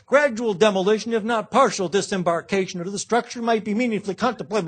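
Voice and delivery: lampooning Kerry's long-winded version of the speech; mock John Kerry. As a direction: parody a windbag senator. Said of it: "if John Kerry had been there, he would have said".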